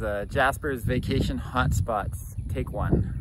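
loud, slow show-title announcement; TV host intro. Pretend. Mock show intro, take one.

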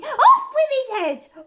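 high, very loud, drawn-out bellow; furious shouting. A narrator's reading. Queen stamps about the game, shouting in fury. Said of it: "stamping about and shouting".

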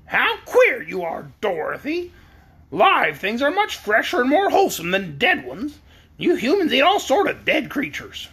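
high hen voice, arguing back; indignant, high-pitched hen; puzzled hen. A narrator's reading. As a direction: lecture the girl as a talking hen. Storyteller voicing the yellow hen.

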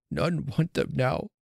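slow, forlorn repetition; understated tearful lament; near tears. A narrator's reading. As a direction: draw out "None want them now" sadly. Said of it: "I could see the tears start".

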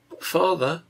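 put-on mildly hesitant child; a touch of reluctance. A narrator's reading. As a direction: broach it gently, unsure. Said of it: "Mary's voice was hesitant".

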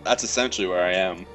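usual speaking voice

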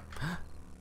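sharp, high breathy gasp; mock gasp. Streamer acts out Alex reacting to the scare, mock gasp. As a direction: gasp as if startled, breath only. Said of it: "And he's like".

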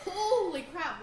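sudden loud high gasp of shock; shocked exclamation. Real, not acted. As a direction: blurt it out in shock. First taste of the hot pepper.

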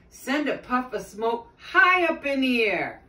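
loud, high storybook excitement; animated storybook reading. A narrator's reading. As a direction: read it out big for a child. Reading picture book to child.

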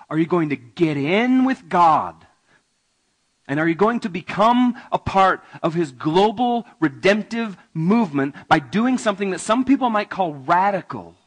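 loud rousing preacher call; rally the congregation to act; full-voiced sermon challenge; preaching challenge. Real, not acted.

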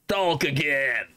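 slow, drawn-out Austrian-action-star voice; Schwarzenegger. Acted. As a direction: imitate a movie tough guy, stretching each word. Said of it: "Il y avait Schwarzenegger au téléphone". (imitation lente de Schwarzenegger)

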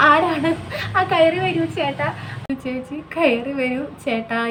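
high, slow, sing-song greeting to visitors; mock sweet welcome. Caught with a face pack on, greeting guests at the door in a high sweet voice. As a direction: put on a sugary host voice at the door. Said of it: "എന്ന് നമുക്ക് പറയാം".

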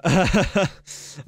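short laugh at a letdown; rueful laugh. Real, not acted. Gamer seeing a poor youth intake, rueful laugh. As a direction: laugh off the bad news.

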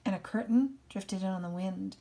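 plain reading voice

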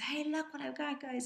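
put-on high-pitched, eager call to the kids; excited announcement. Parent coming home with the Guinness book, excited announcement.

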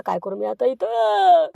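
slow, crying question; slow sobbing plea; tearful despair. Acted. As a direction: let the words break as you weep. Despairing father, crying heard.

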